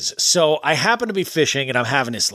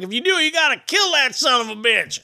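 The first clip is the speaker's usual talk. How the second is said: higher-pitched, lightly voiced drunk local; eager, pitched-up angler chatter; drunk fishing regular; 11 semitones higher than usual. Host voices the drunk regular at his fishing spot, pitched up. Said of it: "He's like".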